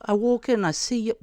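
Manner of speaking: high, loud mock complaint; high-pitched sweary-auntie banter; OG Māori lady. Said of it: "that's how she talks".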